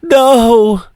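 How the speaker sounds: high, sobbing plea; crying hard. Acted. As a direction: wail his name through sobs. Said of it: "She starts crying really hard now".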